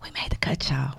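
breathy whispered aside. Acted. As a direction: hush it like a secret. Announcing she made the cut.

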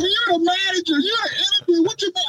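high, incredulous mock questioning; retold disbelief. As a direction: act out your own stunned reply to the manager. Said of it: "I'm like".

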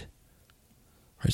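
breathy whisper under the breath; whispered aside. Real, not acted. Host muttering an aside to himself, whispered. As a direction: drop to a whisper for a beat.

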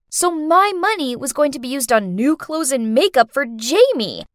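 ordinary delivery, nothing marked

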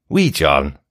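short demonstration of a Scottish accent. Shown as a demonstration. Host coaxing his fiancé to try it, Scottish accent. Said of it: "You can try it".

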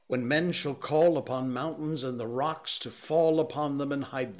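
loud, rising sermon cadence; doom preaching. A narrator's reading. Curate prophesying doom, rising to a sermon. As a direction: preach the end of days.

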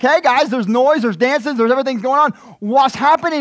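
high, loud puzzled calling out; curious older brother. Retold or imitated. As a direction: play the brother wondering about the noise. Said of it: "he's like".

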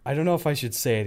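usual speaking voice

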